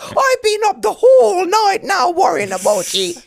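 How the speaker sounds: high, loud mock scold of a mother up all night; mock Irish mammy scolding. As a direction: scold him for coming home at two. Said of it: "now it's going into the Jamaican there".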